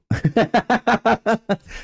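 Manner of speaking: high burst of laughter; loud laugh. Real, not acted. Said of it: "I'm sorry if that goes really loud".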